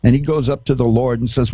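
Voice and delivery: ordinary talk, nothing marked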